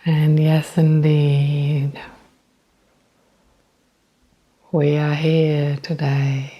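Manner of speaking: measured spiritual address, lingering on phrases; channeled message. Acted. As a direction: speak as gentle guides, unhurried, stretching the words. Channels a message after getting connected.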